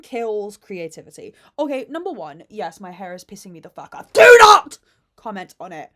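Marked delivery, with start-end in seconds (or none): 4.1-5.9 sudden loud, high yell on "Do not"; shouted warning. Real, not acted. Vlogger warning viewers off her greasy hair, shouted warning. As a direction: yell it as an order, then drop back.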